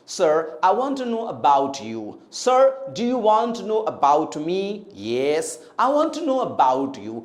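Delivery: very slow example sentences, "about" as "uh-BOUT"; model pronunciation. Shown as a demonstration. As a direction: demonstrate slowly, pressing on "bout" in "about". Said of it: "hundred percent correct pronounce करेंगे". (धीरे-धीरे नमूना वाक्य, "about" में "बाउट" पर ज़ोर)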